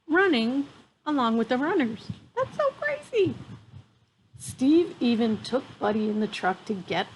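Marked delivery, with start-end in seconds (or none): 2.4-3.3 much higher, squealing with delight; high-pitched real amazement; amazed. Real, not acted. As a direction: gush that it's crazy, way up high. Reacting to otter running race.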